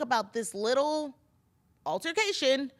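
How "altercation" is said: ordinary delivery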